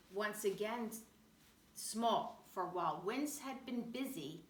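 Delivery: usual speaking voice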